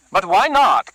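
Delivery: a keen henchman keen to use the cleaver; Carlos, eager thug. Acted. Carlos offering his boss a fix, eager.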